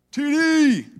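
loud, high call across a crowd. Retold or imitated. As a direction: shout his name like a fan. Crowd calling out to dad.

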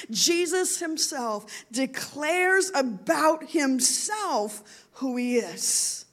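normal talking voice

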